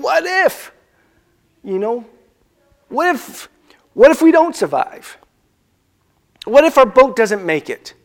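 high, panicky what ifs; panicky disciples. Retold or imitated. Disciples in the storm, imitated panicky high. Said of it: "they're panicky".

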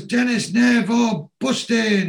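high, loud call to mates; hearty greeting. Retold or imitated. Man greeting his mates in the pub, hearty high call. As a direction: hail your mates across the pub.

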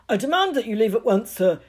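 fast, loud, high bluster; loud demand. Acted. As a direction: bark an order. Vernon ordering the giant out.

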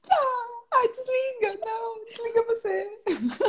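high, soft, coy pleading; giggly late night call. Pretend. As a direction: play the clingy sweetheart who won't hang up. Couple-style goodbye game.

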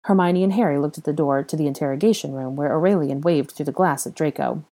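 usual speaking voice

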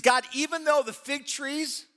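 rising, sung-out preaching that builds then drops to a hush; preaching prayer. Acted. Preacher reads Habakkuk's prayer to the congregation, building then hushed.

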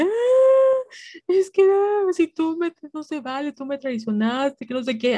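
high, tearful voice breaking on each phrase; retold crying to the boss in the restaurant; imitating crying. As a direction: replay the sobbing plea, voice cracking up high. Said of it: "así llorando".

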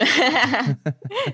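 bursting into helpless laughter; high giggling fit, can't stop; laughing fit. Real, not acted. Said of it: "Ou vais ter um ataque de riso". (ataque de riso)

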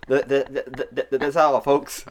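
stuttering cartoon pig sign-off; Porky Pig stutter. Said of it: "That's a Looney Tunes reference".

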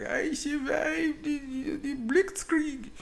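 put-on high, slow, strained old survivor cry; high slow quavering old Russian voice. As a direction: play a frail old Russian shouting his story. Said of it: "old Russian person and be like".

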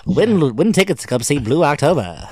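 loud, high radio announcer hype. Retold or imitated. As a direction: hype it like a cheesy radio giveaway. Mocking a radio giveaway ad.

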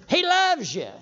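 loud, high, ringing declarations; full-force preaching. Real, not acted. Preacher assuring the congregation of God's love, fervent shouted preaching. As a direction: punch each "loves" high and hard.

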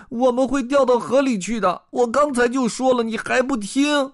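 high, loud panicky fish voice; high-pitched frightened complaint; shouting goldfish. Acted. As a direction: scold up high in alarm. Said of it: "鲸鱼咕噜咕噜地吐着泡泡喊道".

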